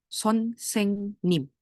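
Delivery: Son Seng Nim slowly, each syllable apart. Shown as a demonstration. Teacher reading the assembled word to learners, slowly separating Son, Seng, Nim.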